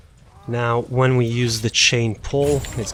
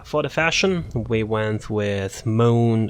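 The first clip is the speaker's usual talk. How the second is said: ordinary delivery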